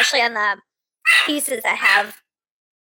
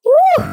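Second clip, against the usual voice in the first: sudden high loud whoop; hot-food whoop; 8 dB louder than usual. Real, not acted. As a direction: yelp at the burn of spice. Reacting to very spicy bite.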